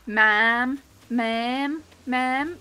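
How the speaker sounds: drawn-out "ma'am", lightly Americanised; American pronunciation. Shown as a demonstration. Streamer answers a teasing chat about her accent, American attempt. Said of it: "Is that more American for you".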